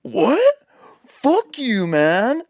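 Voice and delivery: high, sudden outraged retort; mock angry retort. The caught litterer yelling back at the narrator, high and outraged. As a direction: imitate a stranger flaring up. Stranger snaps back angrily.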